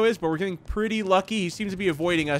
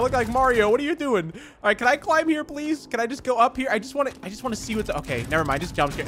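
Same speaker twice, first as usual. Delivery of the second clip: usual speaking voice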